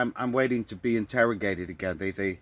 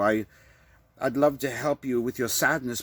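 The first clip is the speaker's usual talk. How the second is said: ordinary talk, nothing marked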